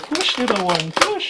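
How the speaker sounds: high coaxing push, rising to a cry; mock midwife. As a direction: urge the toy out like a midwife, hammed-up. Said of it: "I'm like".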